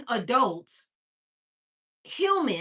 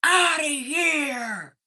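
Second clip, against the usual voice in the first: long drawn-out, higher holler; man's loud order; 4 semitones higher, 4 dB louder, much slower than usual. Retold or imitated. Gamer ordering a kid out, loud drawn-out order. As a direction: stretch the words out as a mock holler.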